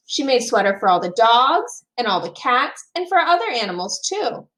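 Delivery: ordinary delivery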